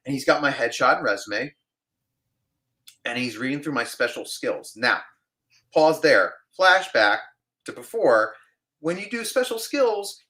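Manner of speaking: normal talking voice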